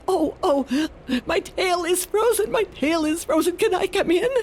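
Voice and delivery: a freezing fox asks the animals to let him in; cold fox. Acted. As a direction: beg like a fox with a frozen tail. Fox pleads tail frozen.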